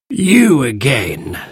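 slow, weary creature voice; irritable old magical creature; grumpy sand fairy. A narrator's reading. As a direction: greet them with drawn-out annoyance. Grumpy sand fairy greets the children.

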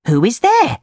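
high, challenging call from a hidden character; unseen voice calling. Acted. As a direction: call out to find who came in. Unseen cricket calls out.

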